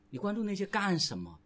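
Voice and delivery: ordinary delivery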